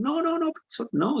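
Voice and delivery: mock scream. Krishna cries out as his mother ties him, high mock scream. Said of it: "Krishna was screaming".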